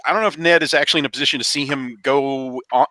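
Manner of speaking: ordinary talk, nothing marked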